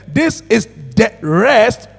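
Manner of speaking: slow, solemn reading of scripture. Acted. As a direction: read it slowly like holy writ. Rereading scripture slowly.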